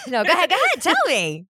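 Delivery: high, loud, laughing insistence. Real, not acted. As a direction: urge them on through real laughter. Laughing, urging co-host on.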